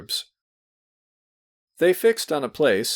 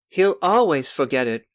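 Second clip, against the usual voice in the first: put-on high, louder chiming-in girl; teasing girl; 5 semitones higher, 5 dB louder than usual. A narrator's reading. Youngest girl joining the teasing, high and loud. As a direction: chime in like a mischievous little sister. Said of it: "the fifth Zachlebinin girl put in".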